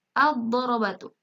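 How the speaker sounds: "ad-darabatu" with a heavy doubled D; silent lam. Shown as a demonstration. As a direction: read "ad-darabatu" with no L, lam merged into dad. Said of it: "alif lamnya tidak dibaca jelas".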